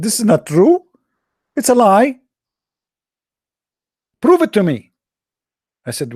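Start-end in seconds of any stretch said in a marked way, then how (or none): 0.0-4.9 much higher, louder outrage; angry denial. Retold or imitated. The caller denying the hadith, high and loud. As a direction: protest it's a lie.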